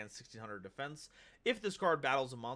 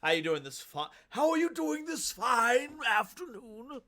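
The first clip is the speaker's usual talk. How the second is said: put-on old man voice; 9 semitones higher, 3 dB louder than usual. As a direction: play a jolly old man greeting guests. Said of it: "I'm gonna stop doing my old man voice".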